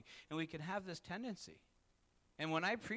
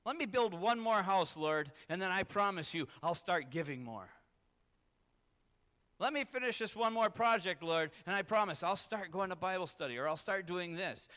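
louder, higher pleading bargain with God; mock pleading excuses; 4 semitones higher, 7 dB louder than usual. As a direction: plead your excuses loudly, half mocking. Voicing excuses to God, preaching.

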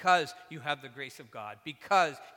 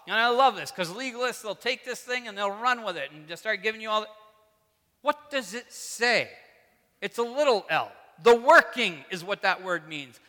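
loud, raised-pitch impassioned preaching; real, worked up; 6 semitones higher, 10 dB louder than usual. Real, not acted. Preacher warning against legalists, getting really worked up. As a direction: get carried away arguing from the scripture. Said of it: "now I'm getting all worked up".

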